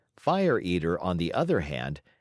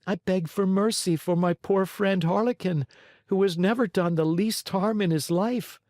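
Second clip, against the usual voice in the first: put-on lightly higher little wooden boy; puppet boy's voice; 10 semitones higher than usual. A narrator's reading. Pinocchio answering the showman, a light boyish voice. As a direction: answer like a small, timid puppet.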